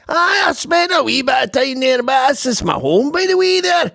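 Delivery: very high, hammed-up Scottish accent; put-on Scottish accent. Host answers doubters about growing up in Canada, shrill exaggerated Scottish accent. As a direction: protest loudly as an over-the-top Scot. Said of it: "doing a Scottish accent".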